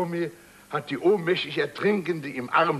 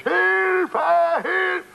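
high mock cries of Hilfe; imitating cries for help; 13 semitones higher, 5 dB louder than usual. The storyteller imitating his drowning fiancée's high mock cries for help. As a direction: call Hilfe in a high panicked voice. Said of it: "Sie schreit um Hilfe".

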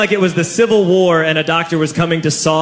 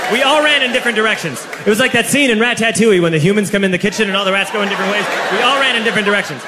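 fast, higher excited telling; breathless rush; 4 semitones higher, much faster than usual. Retold or imitated. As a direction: race through it like you're still running. Frantic retelling of the escape.

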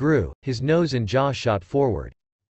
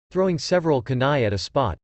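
ordinary delivery, nothing marked

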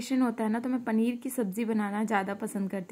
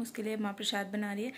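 ordinary talk, nothing marked

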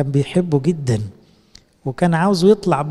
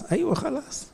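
high reassuring answer; 11 semitones higher, 8 dB quieter than usual. Pretend. God's imagined answer to Peter.

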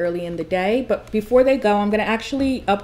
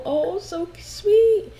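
cooing; 9 semitones higher, much slower than usual. Real, not acted. Cooing at the kiss.

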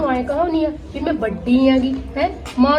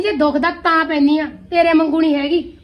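normal talking voice

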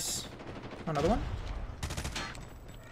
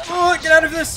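frustrated yell; 10 semitones higher, 9 dB louder than usual. Real, not acted. Frustrated yell at enemy mid-fight.

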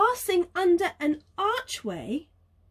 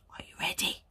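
whisper; 10 dB quieter, faster than usual. Real, not acted. Whispered prompt to child.

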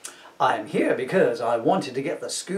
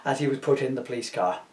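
usual speaking voice

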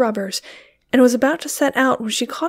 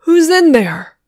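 firm, loud demand, higher; 6 semitones higher, 8 dB louder than usual. A narrator's reading. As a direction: call the hidden one out firmly. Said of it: "demanded David Gleason".

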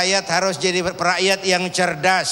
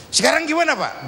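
curious son; 6 semitones higher, faster than usual. Acted. Son asking his father what to do next, high and eager. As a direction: ask like an eager young son.

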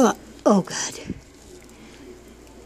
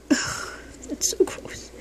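disgusted whisper; 6 semitones higher, 3 dB quieter, much slower than usual. Real, not acted. Disgusted while handling goo.

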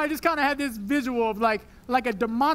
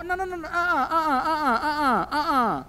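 mock crying refusal; 2 semitones higher than usual.

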